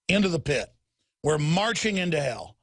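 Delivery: ordinary talk, nothing marked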